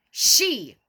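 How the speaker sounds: ordinary talk, nothing marked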